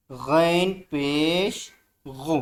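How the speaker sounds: very slow drilled syllable; slow recitation. Shown as a demonstration. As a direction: model it slowly for the pupil. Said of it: "ज़ोर से बोलें".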